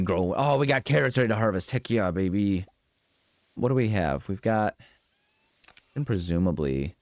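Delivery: ordinary talk, nothing marked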